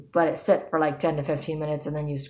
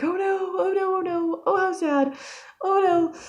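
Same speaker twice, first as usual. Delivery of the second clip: mock wail, pitched way up high; retold dismay. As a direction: act out helpless dismay in falsetto. Retells her dismayed first reaction.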